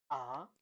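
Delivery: Mandarin second tone on AH, sliding upward. Shown as a demonstration. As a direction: let AH climb like a question. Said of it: "this is the second tone".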